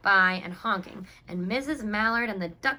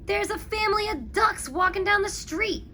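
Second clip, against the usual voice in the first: higher, louder excited policeman; Michael on the phone; 11 semitones higher, 5 dB louder than usual. A narrator's reading. Michael calling Clancy at headquarters, excited. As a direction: report it urgently like a young cop. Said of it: "He called Clancy at headquarters and said".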